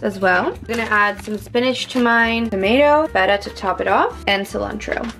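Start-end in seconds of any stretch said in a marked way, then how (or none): none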